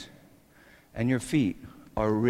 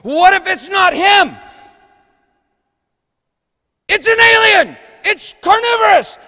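high, loud panicked shout; 14 dB louder than usual. Retold or imitated. Technician warning the room, high panicked shout. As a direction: scream the warning in terror. Ride staff panic retold.

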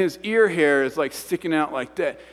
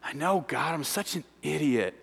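soft, defeated admission; small, crushed apology; hurt, self-blaming response; 9 dB quieter than usual. Retold or imitated. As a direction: sigh and beat yourself up. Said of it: "response of people that have been hurt by church".

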